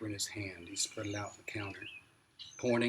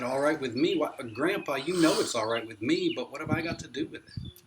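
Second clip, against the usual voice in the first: higher, louder stunned excitement; dumbfounded; 6 semitones higher, 10 dB louder than usual. A narrator's reading. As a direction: blurt it out in disbelief. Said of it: "I was dumbfounded".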